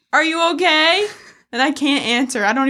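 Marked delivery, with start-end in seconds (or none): 0.1-1.1 mildly raised, higher call; imitated calling out. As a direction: call back to a straggler on the trail. Friends call back from up the trail.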